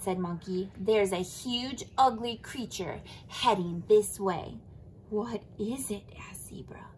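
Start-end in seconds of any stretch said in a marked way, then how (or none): 0.8-4.6 slow ominous warning. Acted. Monkey warns Zebra. 5.1-6.0 frightened whisper. Acted. Zebra asks, frightened.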